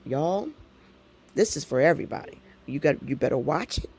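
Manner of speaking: usual speaking voice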